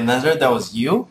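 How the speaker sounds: normal talking voice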